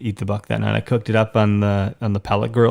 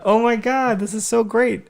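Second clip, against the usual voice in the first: imitating a delighted wife, much higher pitch; happy wife; 12 semitones higher than usual. Wife on FaceTime sees the buck, imitated as happy. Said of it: "she's obviously happy".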